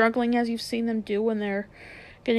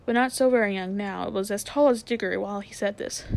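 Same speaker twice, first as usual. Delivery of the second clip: plain reading voice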